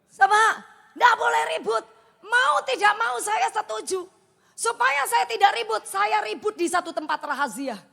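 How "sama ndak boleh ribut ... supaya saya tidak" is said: higher, louder shout of the rule; preaching shout. Real, not acted. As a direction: drive it home to the congregation. Preacher hammering the point.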